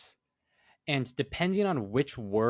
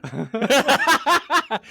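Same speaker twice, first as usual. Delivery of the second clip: laughing mock anime word; 12 semitones higher, 10 dB louder than usual. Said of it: "mock anime words".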